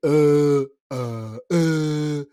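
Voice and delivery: long, drawn-out "euh"; filler "euh". Shown as a demonstration. Coach imitates sounded pauses to avoid, droning "euh". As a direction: stall with droning uhs. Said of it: "des pauses sonores".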